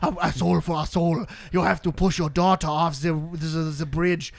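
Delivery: halting, badly faked German accent; bad German villain accent, stumbling and dragging at the end; terrible German accent. Red Skull tells Thanos the price, terrible German accent. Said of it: "Which is a terrible German accent".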